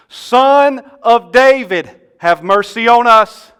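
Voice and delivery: loud, high, slow cry for mercy; shouting. Acted. Two blind men calling to Jesus for mercy, shouting. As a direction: call out desperately over a noisy crowd. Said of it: "They gotta shout".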